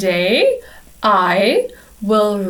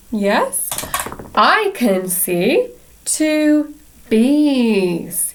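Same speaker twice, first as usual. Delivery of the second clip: usual speaking voice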